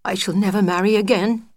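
lightly voiced mouse, fast, declaring; a touch of dramatic widow's vow, quick. Widow swearing off marriage to her friend, mild dramatic vow. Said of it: "she said".

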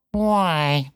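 terse cat voice; bitten-off words. A narrator's reading. Cat questioning the runaway piglet, word bitten off. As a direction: spit out the single word. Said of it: "seemed to bite off its words and spit".

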